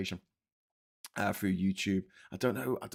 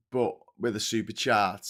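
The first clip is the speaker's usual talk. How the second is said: ordinary delivery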